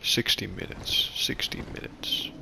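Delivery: whispered under his breath; just breathe the words out. Real, not acted. Gamer whispering the download time to himself.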